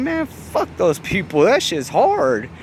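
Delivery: high, slow cop voice; cop voiced. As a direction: play the cop, high and stretched out. Cop's reply, voiced.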